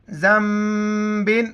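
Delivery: ذنبن slowly, with the نون turned to a م before ب; nun becomes mim. Shown as a demonstration. Teacher demonstrating iqlab rule, slow careful reading. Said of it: "اس کو میم پڑھیں گے".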